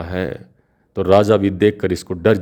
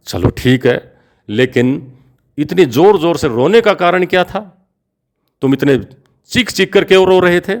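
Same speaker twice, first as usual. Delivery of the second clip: much higher, louder astonished demand; the king, amazed, demanding why he wept; incredulous king; 9 semitones higher, 3 dB louder than usual. A narrator's reading. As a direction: push hard, unable to believe it. King presses about the crying.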